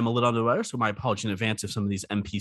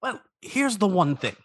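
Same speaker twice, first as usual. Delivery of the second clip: lightly voiced lonely crab; a hint of a needy crab; crab character voice; 6 semitones higher than usual.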